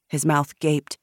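ordinary delivery, nothing marked

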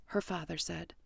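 usual speaking voice

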